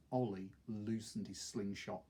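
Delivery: plain reading voice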